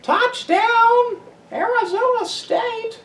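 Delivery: very high, mocking female commentator; annoying announcer impression. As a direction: squeal the call in a drawn-out falsetto. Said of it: "That's what she sounds".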